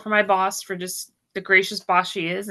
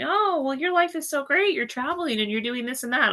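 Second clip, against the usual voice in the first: mocking; 7 semitones higher than usual. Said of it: "people just on social media going".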